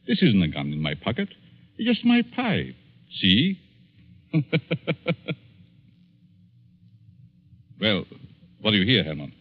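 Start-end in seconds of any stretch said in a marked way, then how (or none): 4.3-5.3 mocking laugh. Villain laughs about the pipe.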